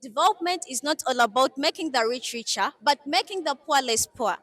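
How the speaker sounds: formal address to an audience; formal speech. Acted. Head girl opening her speech to viewers, formal address. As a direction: speak like at a podium.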